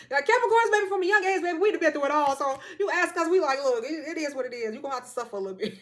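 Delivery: high, loud, sassy preaching; loud sassy rant. Real, not acted. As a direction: belt it with sass.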